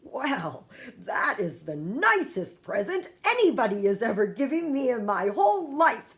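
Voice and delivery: a giant moved by the nicest present; delighted cry. A narrator's reading. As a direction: gush with gratitude. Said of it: "cried the B F G".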